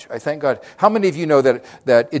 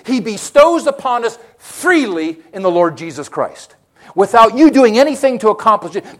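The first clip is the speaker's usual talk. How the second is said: rising, louder preacher's build; preaching at full force; 5 semitones higher, 7 dB louder than usual. Acted. Preacher rouses the congregation, loud and high. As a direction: drive the point home to the church.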